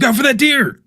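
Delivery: sudden loud, high mock alarm; mock warning shout. Streamer jokingly warns a driver about a deer, mock shout.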